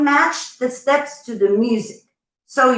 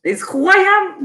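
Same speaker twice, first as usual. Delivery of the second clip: mock dramatic. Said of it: "I am a dramatic person".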